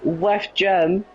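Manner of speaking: rising, excited race call; motorsport commentator. Real, not acted. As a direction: call the overtake live. Live race play-by-play.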